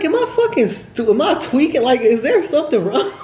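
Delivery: high-pitched, laughing disbelief; stunned disbelief. Retold or imitated. Telling viewers how Noah leaving stunned her, laughing disbelief. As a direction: replay her shock, laughing through it.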